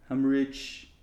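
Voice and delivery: rough attempt at an impersonation; Donald Trump. Attempting the cartoon job's voice, half-hearted impersonation. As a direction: try the politician's voice, slowing at the end. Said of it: "your best Donald Trump impersonation".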